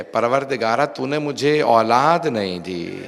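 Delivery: normal talking voice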